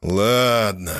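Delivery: long drawn-out, grudging agreement; drawled mock displeasure. A narrator's reading. Husband gives in to his wife, drawling with feigned displeasure. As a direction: stretch the word out, feigning reluctance. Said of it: "протянул я, изображая недовольство".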